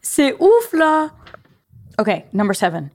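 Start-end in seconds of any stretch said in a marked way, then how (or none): 0.0-1.1 very high-pitched French exclamation; high, playful astonishment; amazed exclamation. Acted.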